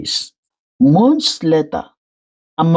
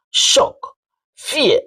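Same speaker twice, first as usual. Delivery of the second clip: usual speaking voice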